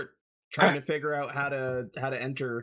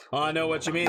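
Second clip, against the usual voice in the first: ordinary talk, nothing marked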